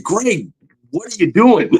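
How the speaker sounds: high-pitched, incredulous talk to himself; mock alarm, pitched well up; alarmed self-scolding. His brain waking up to scold him mid-charge, alarmed.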